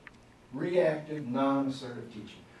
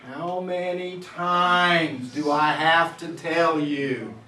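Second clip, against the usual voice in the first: exasperated teacher; 2 semitones higher, 7 dB louder, much slower than usual. Retold or imitated. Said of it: "What's another thing I said to him?".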